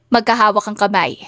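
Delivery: plain reading voice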